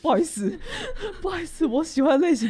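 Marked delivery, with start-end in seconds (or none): 0.6-1.2 high, soft, embarrassed giggle. Real, not acted. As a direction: giggle at your own confession. Embarrassed laugh about her type.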